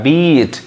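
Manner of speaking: बीट with the ee drawn out; long vowel. Shown as a demonstration. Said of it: "long sound इसको बोलते हैं".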